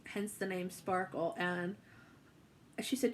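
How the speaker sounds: ordinary talk, nothing marked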